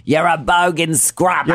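sling the insult in a cartoon Australian accent; horrible Australian accent. Hosts trade insults in a put-on bad Australian accent. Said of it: "our horrible Australian accent".

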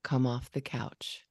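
low, slow, steady parent voice. Shown as a demonstration. Parent demoing a low, slow voice to son. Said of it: "a little bit deeper than you normally talk and slower".

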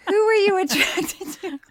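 high and laughing, hamming it up; high, laughing mock question. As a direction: ask it high through a laugh.

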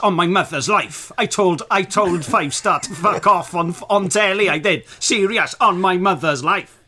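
loud insistent schoolboy swearing it's true; mock school liar. School liar loudly swearing on his mother's life. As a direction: bellow it like a kid insisting he's not lying. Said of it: "claim that was".

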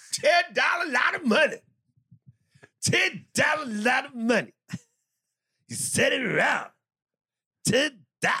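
high, loud mock holler; shouted hawker bit. Host breaking into a catchphrase after listener praise, high shouting. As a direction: belt it out high, line by line.

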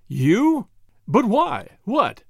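spluttering. A narrator's reading. Nobleman learns the detective hired the man, spluttering. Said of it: "The nobleman spluttered impotently".

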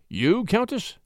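high, rising question; surprised query. A narrator's reading. The Marquis questions the Countess, surprised. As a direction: lift it sharply in polite surprise.

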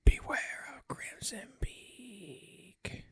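very slow, drawn-out eerie whisper; ghostly whisper. Acted. Mother's ghost warning her daughter in bed, whispered. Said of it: "and whispers".

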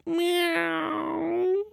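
long, high mewing meow; a high, drawn-out cat's meow. Acted. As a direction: meow like a sweet little cat. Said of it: "the cat looks up".

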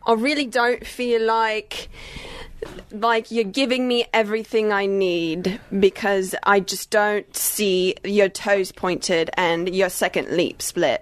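put-on slow, deliberate judge with an accent; exacting TV dance judge, very slow; Nigel Lithgow. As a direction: deliver a drawn-out critique in a put-on accent. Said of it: "I was Nigel Lithgow on So You Think You Dance".

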